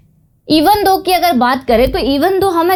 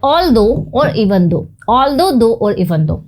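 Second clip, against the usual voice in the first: slow teaching; much slower than usual. Shown as a demonstration. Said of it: "teacher presents the conjunctions".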